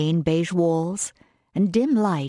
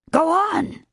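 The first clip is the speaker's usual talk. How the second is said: high, impatient French exclamation; French accent; 12 semitones higher, 3 dB louder than usual. A narrator's reading. French chef urges the hesitant maid, high French accent. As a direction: urge her on sharply in a French accent. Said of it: "with that strong French accent".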